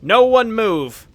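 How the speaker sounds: loud, high, drawn-out order to halt; commanding shout. Acted. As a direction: call out slowly and stop everyone in place. Game figure halts the attackers.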